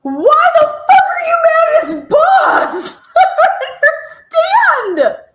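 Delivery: outraged laughing shout. Real, not acted. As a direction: yell it out through laughter, baffled. Incredulous outburst at the clip.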